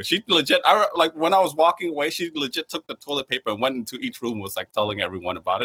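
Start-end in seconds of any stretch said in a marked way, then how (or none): none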